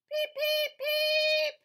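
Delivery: very high, loud little beeps; squeaky duckling peeps. Acted. Reading to a child, duckling peeping squeakily. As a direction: peep like a tiny duckling.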